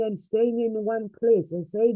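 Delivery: ordinary delivery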